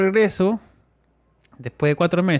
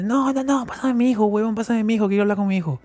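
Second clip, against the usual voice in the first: higher-pitched insistent mother; a mom wanting her son on the phone, higher; mother demanding; 6 semitones higher than usual. Retold or imitated. (madre insistiendo, voz más aguda)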